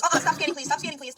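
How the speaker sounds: imitated firm interruption. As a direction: cut the cashier off, imitating the mom. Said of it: "Literally just like that".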